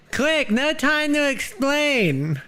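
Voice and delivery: very high, slow put-on voice; high silly voice. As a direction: drawl it out in a squeaky voice.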